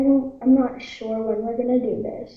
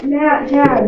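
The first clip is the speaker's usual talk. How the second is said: louder calling voice; yelling down; 3 semitones higher, 7 dB louder than usual. Real, not acted. Kid tries to reach dad downstairs, calling out. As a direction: call two names down the stairs. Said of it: "Yell down there".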